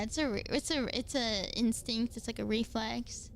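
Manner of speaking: ordinary talk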